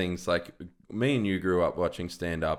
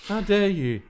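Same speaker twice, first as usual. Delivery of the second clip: mock outrage; 12 semitones higher, 6 dB louder than usual. Mock outrage over character names.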